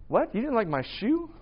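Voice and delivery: incredulous, pitch rising on the question; mock offended. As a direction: play a touchy friend taking offense. Acting out a touchy friend.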